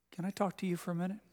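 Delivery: a son quietly asks his father for a talk, earnest; soft earnest request. Retold or imitated. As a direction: ask quietly, like a son needing his dad. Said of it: "he'd hear that tone in my voice".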